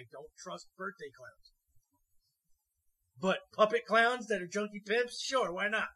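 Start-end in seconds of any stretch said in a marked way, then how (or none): 3.2-5.9 loud, mock-approving; sarcastic sure, why not; sarcastic enthusiasm. Real, not acted. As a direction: sell the absurd idea with fake cheer.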